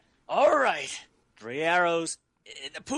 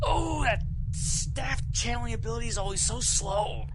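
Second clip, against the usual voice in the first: normal talking voice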